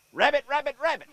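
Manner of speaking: high, loud retold holler. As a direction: call it out high like the campers did. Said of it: "we heard".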